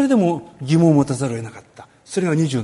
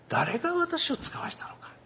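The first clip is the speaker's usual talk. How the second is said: whisper; 11 semitones higher, 7 dB quieter than usual. Acted. Rhetorical question, hushed.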